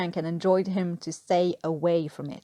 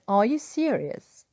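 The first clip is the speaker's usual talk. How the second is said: incredulous; 3 semitones higher, slower than usual. A narrator's reading. Imagined stare line.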